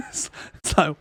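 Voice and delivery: usual speaking voice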